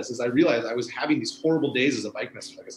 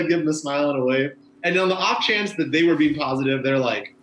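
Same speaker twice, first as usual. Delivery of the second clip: normal talking voice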